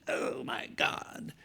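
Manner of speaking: slow breathy under-the-breath groan; private exasperation. Retold or imitated. Woman thinking privately about her picky friend, slow hushed dismay. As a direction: say it to yourself, slowly and unvoiced.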